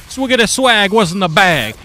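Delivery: wannabe rapper. Retold or imitated. Rapper's cousin walks up to the narrator, cocky high voice.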